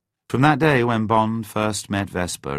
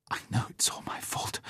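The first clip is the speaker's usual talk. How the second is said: whispered through tears; tearful whisper; 12 dB quieter than usual. A narrator's reading. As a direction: breathe it out, crying quietly. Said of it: "She dabbed at her eyes".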